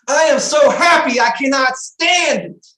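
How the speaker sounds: loud, high, near tears with joy; overjoyed. Real, not acted. Founder gushing to the group, overjoyed and tearful. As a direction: gush it out, voice cracking with happiness.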